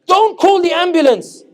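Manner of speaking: high, loud, slowed astonishment; shocked disbelief. Retold or imitated. British friends shocked that nobody calls an ambulance. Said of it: "we British went".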